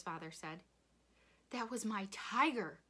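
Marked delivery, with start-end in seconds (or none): 1.5-2.8 loud, raised cry of outrage; angry scream. A narrator's reading. Rob to the father who shot his tiger, screaming. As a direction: hurl it at him. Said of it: "Rob screamed".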